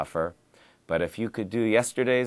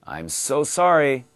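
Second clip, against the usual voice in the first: apologetic dialogue line; 5 semitones higher, 6 dB louder than usual. Shown as a demonstration. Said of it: "today's dialogue".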